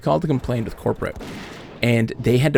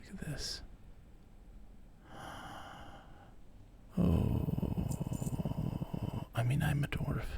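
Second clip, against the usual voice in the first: soft unvoiced whisper; whispering to himself while gaming; whispered gaming aside; 3 semitones higher, 16 dB quieter than usual. Real, not acted. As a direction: hush it to yourself while playing.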